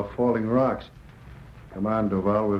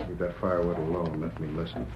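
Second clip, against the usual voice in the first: low, much softer, listening hard; hushed; 5 semitones lower, 6 dB quieter than usual. Acted. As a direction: drop to a tense hush. Straining to hear an intruder.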